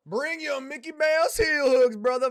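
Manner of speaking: loud, high hyped fighter callout; pumped trash talk shout; hype callout. Acted. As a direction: bark it like a fight promo. Reading chat callout as hype.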